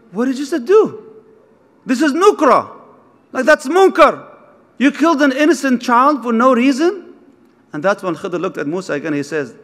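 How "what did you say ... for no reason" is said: higher-pitched shocked outburst; outraged protest. Retold or imitated. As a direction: cry out against an injustice. Musa outraged at the killing.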